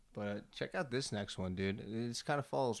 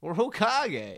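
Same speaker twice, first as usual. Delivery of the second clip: excited; 10 semitones higher, 8 dB louder, much slower than usual. Acted. Echoing dream job excitedly.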